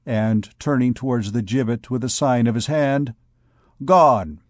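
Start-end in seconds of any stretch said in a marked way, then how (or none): 3.8-4.4 auctioneer. Acted. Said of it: "imitating the accent of an auctioneer".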